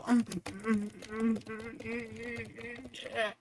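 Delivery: high, soft humming imitation of rapid fire; fading high mm-mm gun sound; mocking gun noise. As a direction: hum the weapon like a mocking kid. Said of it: "where you go".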